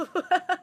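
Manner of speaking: short high laugh; burst of laughter. Real, not acted. Laughs at own story.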